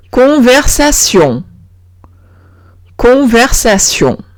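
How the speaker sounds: "conversación" in French, ending in nasal syon; French -tion. Shown as a demonstration. Teacher modelling the -tion example, slow French pronunciation. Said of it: "se pronuncia sion".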